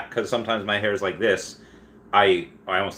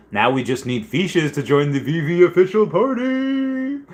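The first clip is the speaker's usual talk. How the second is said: higher, slower put-on read; playful chat voice; 7 semitones higher, slower than usual. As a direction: read it like an eager fan.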